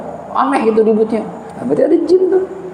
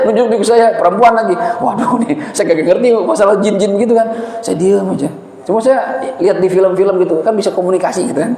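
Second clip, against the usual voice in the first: ordinary talk, nothing marked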